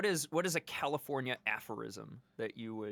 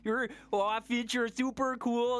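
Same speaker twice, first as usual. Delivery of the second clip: higher cooing voice like petting a puppy; puppy talk; 9 semitones higher, 3 dB louder than usual. Retold or imitated. Imitating talking to Finch like a puppy, cooing. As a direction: coo it as to a found puppy. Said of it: "Talking to Finch like they're a puppy".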